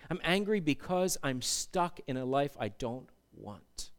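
soft, low acted admission; subdued anger. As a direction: confess the grievance quietly and heavily. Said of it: "might be sitting there in anger today".

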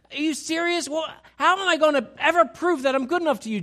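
loud, high, pleading outburst; impassioned Peter. Acted. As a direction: pour out indignant devotion at full force. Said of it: "He says".

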